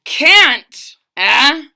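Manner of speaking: furious king scoffing; terrible rage. Acted. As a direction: explode at the word can't. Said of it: "flew into a terrible rage".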